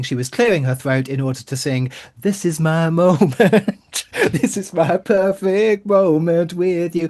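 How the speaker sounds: usual speaking voice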